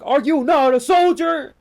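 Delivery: loud, high sergeant yell; drill sergeant. Retold or imitated. Imagined drill sergeant berates the speaker, loud outraged yell.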